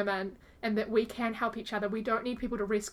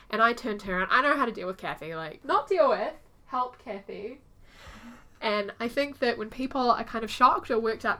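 usual speaking voice

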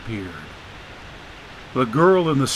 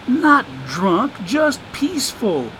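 put-on higher, sly voice of an old drifter; streetwise homeless man; 9 semitones higher than usual. [name] teasing Dagan about his concussion, sly drawl. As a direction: play the wisecracking drifter, amused.

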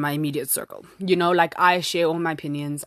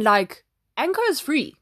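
usual speaking voice

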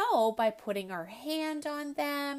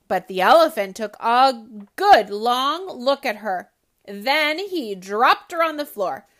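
lively storybook read-aloud voice; story narration; 2 semitones higher, 12 dB louder than usual. A narrator's reading. Host reading a picture book to children, animated.

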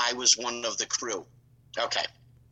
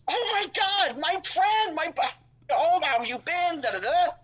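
much higher, gushing hello; excited greeting; 13 semitones higher, slower than usual. Retold or imitated. Vince greeting an old crew friend, gushing and much higher. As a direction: imitate the boss thrilled to see you.

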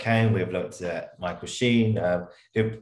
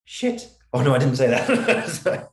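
high, loud yelp then laughing; startled swear; 6 semitones higher, 5 dB louder, slower than usual. Retold or imitated. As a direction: caught out, half-laughing. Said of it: "You go".

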